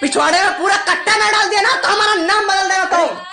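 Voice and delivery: very high-pitched furious dare; angry challenge. Acted. Son threatens uncle who threatened to bury him, furious dare. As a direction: dare him to lay a hand on you, voice pitched right up.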